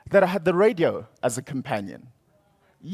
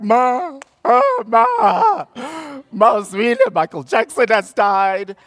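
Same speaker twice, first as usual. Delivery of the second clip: much higher and louder, calling out to mother in distress; frantic wailing; 8 semitones higher, 6 dB louder, slower than usual. Retold or imitated. Teen at parents' door announcing [name]'s death, frantic loud wailing. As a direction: burst in crying out for mother. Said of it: "I'm like".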